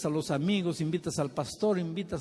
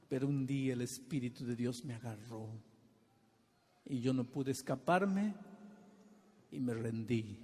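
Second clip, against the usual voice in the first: softer and lower, humbled; hushed surrender; 4 semitones lower, 4 dB quieter than usual. Retold or imitated. Retired soldier telling the pastor he surrendered, hushed. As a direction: let it sink to a quiet confession. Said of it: "con ojos emocionados me decía".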